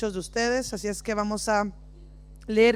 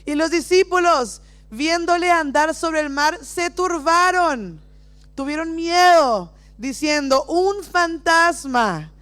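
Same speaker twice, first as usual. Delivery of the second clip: dramatic reading; 3 semitones higher, 3 dB louder, slower than usual. Acted. Reads disciples' fright dramatically.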